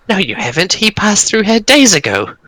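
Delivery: high, scoffing goblin voice; mocking goblins. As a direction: goblins laughing off a bragging hero. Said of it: "they kind of start laughing and they go".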